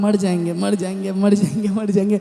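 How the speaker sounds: repetitive mock-defeatist chant; imitated doomed muttering. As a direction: repeat it on one note, imitating a doubter. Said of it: "आप बोल रहे हैं". (हार माने हुए की रट की नकल)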